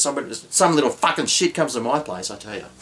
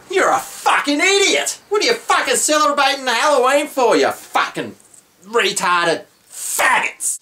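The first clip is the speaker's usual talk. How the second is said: much higher, hammed-up shouting insult; mock yell; 9 semitones higher than usual. Aussie mocking countries that celebrate Halloween, mock yell. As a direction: bellow it like a mock tantrum.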